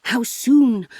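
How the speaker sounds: loud, urgent question; eager gasp. A narrator's reading. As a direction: ask it urgently, with a touch of a gasp. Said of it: "gasped Bernard".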